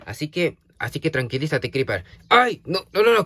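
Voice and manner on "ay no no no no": high, loud startled cry; alarmed yell. Real, not acted. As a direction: yelp and shout to stop him. Creeper starts a fight again. (grito asustado)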